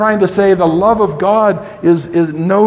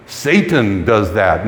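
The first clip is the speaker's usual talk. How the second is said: much lower, muffled voice for the naysayers; mock skeptic; 10 semitones lower than usual. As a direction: drop low to mimic what some people say. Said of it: "Some people say".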